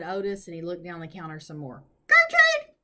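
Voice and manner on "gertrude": loud, very high parrot screech; piercing bird shriek; parrot scream. Acted. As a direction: squawk it out like an irritating parrot. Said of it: "the parrot screamed again".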